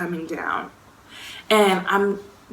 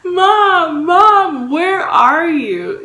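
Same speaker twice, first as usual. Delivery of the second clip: high, loud, drawn-out calling; re-enacted panicked call; acted yelling; 9 semitones higher, 8 dB louder, much slower than usual. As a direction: holler through the house searching for your mom. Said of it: "yelling for my mom".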